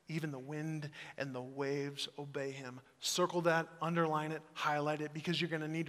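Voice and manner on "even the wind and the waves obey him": hushed reverent repeat. Shown as a demonstration. A pastor savouring his favourite verse, hushed. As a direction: let the verse sink in quietly. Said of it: "one of my favorite lines".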